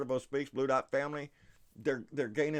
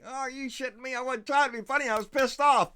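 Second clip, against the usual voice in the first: indignant protest; 10 semitones higher, 6 dB louder than usual. Real, not acted. Protests viewers found rant funny.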